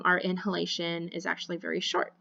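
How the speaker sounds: ordinary talk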